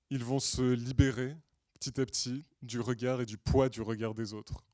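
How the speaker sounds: normal talking voice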